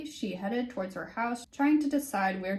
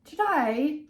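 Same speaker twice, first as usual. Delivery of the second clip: put-on British accent, higher and louder, announcing; a teacher's British accent, hammed up; 7 semitones higher, 6 dB louder than usual. Teacher opening the seminar in a British accent. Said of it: "he's gonna be British".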